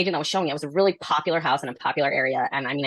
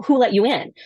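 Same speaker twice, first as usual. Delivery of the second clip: higher, louder indignant question; mock indignant; 7 semitones higher, 5 dB louder than usual. Retelling the seller's challenge, indignant. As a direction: demand it like the confused client. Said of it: "He goes".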